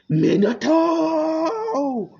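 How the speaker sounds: long drawn-out high roar of the name; furious bellow. Acted. Caged Nine Tails raging after Minato with a long high roar. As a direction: howl the name from inside the cage.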